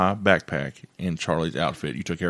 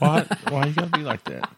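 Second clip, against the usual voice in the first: laughing; 7 semitones higher than usual. Real, not acted. Laughing at a mean joke.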